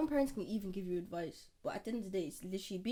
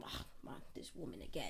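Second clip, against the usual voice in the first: whispered, lower weary grumble; inner grumble; 9 semitones lower, 10 dB quieter than usual. Retold or imitated. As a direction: sigh it under your breath like a fed-up kid. Kid's inner thought about mom.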